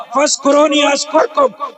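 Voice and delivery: quick Arabic chanting of a verse; Quranic recitation. Acted. Preacher quoting the Quran to the crowd, recited. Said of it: "قرآن نے کہا".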